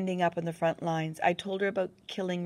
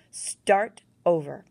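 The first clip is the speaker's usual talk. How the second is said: slow, loud, firm command; a demanding, louder "start over"; 9 dB louder, slower than usual. Acted. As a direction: lay down the order slowly. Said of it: "she said demandingly".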